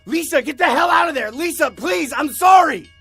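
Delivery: high, full-force panicked shout; screamed, frantic pleading; desperate shouting. A narrator's reading. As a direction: shout with all you have to get her off the tracks. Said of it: "I began shouting".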